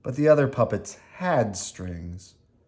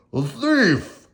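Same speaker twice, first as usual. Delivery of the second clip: high, loud outraged villain cry; Stromboli crying thief; 10 semitones higher, 6 dB louder than usual. Acted. Stromboli catching Geppetto at the cage, loud and outraged. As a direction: bellow the accusation. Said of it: "he cried".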